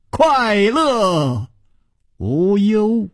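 high, drawn-out chant-like call; carefree parting call. A narrator's reading. Chu Liuxiang leaving through the window, carefree drawn-out call. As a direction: sing out slowly, as if calling back from outside. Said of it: "只听他的笑声自窗外传来".

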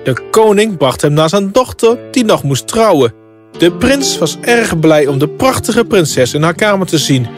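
ordinary delivery

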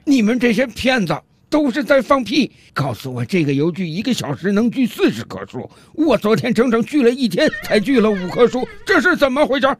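high-pitched outburst of a cheated customer; angry yelling. Acted. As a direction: yell accusingly at the clerk, pitch jumping up. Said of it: "冲服务员大声喊道".

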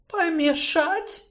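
slow, high moan. Acted. Sorceress moans at the gnome's demand. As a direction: groan it out. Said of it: "простонала та".

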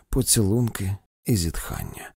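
ordinary delivery

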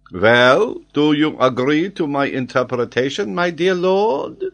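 put-on high, loud French-accented question; pressing French geographer. Geographer asks Glenarvan to agree, triumphant. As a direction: press for agreement, rising at the end.

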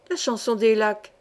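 ordinary delivery

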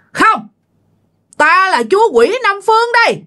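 very high, unyielding demon refusal; high, firm demon voice. Retold or imitated. Demon king answers the grandmother through the mother, high and firm. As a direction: refuse and proclaim yourself, pitched right up. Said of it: "Giọng cao mà nghe nó có vẻ cứng cáp".

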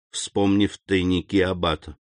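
ordinary delivery, nothing marked

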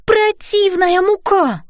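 high, drawn-out scolding outcry; exasperated cry. A narrator's reading. Fairy mother scolding the naughty flour, exasperated cry. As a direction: cry out crossly, stretched and high. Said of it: "закричала мамочка-фея".

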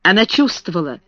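usual speaking voice

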